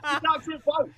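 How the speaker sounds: words through laughter; laughing. Real, not acted. Laughing mid-story.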